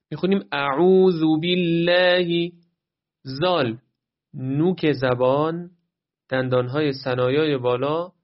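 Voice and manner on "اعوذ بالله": slow اعوذ بالله with عو held long; measured recitation. Shown as a demonstration. (اعوذ بالله با کششِ شمرده)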